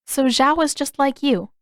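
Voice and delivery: put-on girl's voice. A narrator's reading. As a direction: needle him with the comparison.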